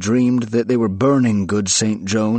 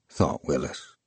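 ordinary delivery

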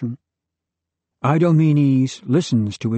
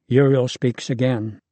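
ordinary delivery

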